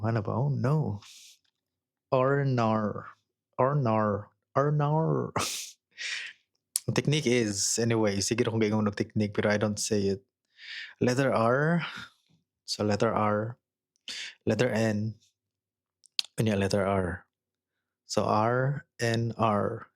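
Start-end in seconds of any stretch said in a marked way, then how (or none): 0.3-5.3 Australian accent: "Or nar" with a long rounded Aussie vowel; Australian O sound. Shown as a demonstration. Teaching the Aussie "oh no", drawn-out Australian vowel. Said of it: "Or nar". 18.2-19.9 Australian accent: "R N R" said slowly letter by letter; R N R drill. Shown as a demonstration. Drilling the Australian "oh no" as slow letters. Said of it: "paspason paglitok".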